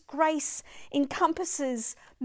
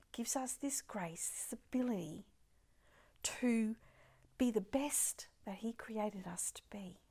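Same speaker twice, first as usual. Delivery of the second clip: hushed, breathy whisper to the congregation; 2 semitones lower, 6 dB quieter than usual. Real, not acted. Preacher whispering about God's grace to the congregation. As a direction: lean in and whisper it.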